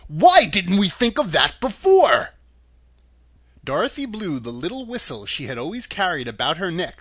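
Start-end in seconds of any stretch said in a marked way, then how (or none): 0.1-2.3 loud, slow, higher-pitched dopey cry; drawn-out, loud goofy exclamation; Scarecrow. A narrator's reading. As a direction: cry out like a dim straw man. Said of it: "cried the Scarecrow".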